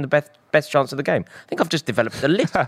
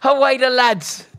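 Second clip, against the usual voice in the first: loud, high celebrating shout; excited shout; 13 semitones higher, 3 dB louder, much slower than usual. Real, not acted. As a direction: yell for the team. Newcastle fan celebrating live.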